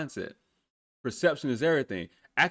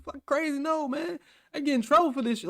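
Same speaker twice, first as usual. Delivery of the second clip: much higher, frantic protest; freaking out; 9 semitones higher than usual. Retold or imitated. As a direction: panic about getting in trouble. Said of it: "I was really freaking out".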